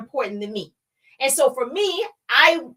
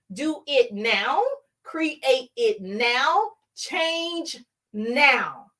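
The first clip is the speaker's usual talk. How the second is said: very slow, word by word; emphatic urging; much slower than usual. Real, not acted. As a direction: stretch out each word as a deliberate command.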